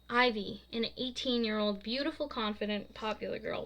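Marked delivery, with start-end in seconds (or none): none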